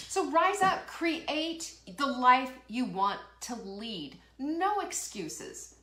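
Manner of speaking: rally them up; caffeinated pep-talk cry; fired up. Real, not acted. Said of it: "I told you I was fired up".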